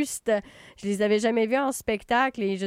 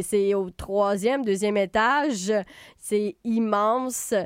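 usual speaking voice

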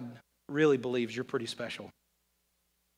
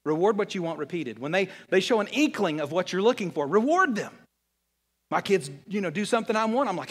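ordinary delivery

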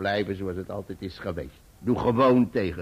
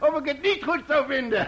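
high, loud joyful laughter; laughing with joy; 4 dB louder than usual. Acted. Uncle to guests about the engagement, laughing loudly. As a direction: laugh it out in delight. Uncle overjoyed retelling consent.

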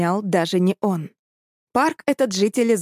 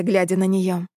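plain reading voice

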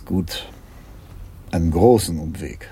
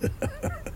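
high, gleeful burst of laughter; a quick delighted cackle; 10 dB quieter than usual. Acted. Chief delights at escape.

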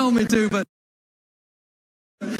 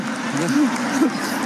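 short laugh; 3 semitones higher than usual. Real, not acted. Still laughing.

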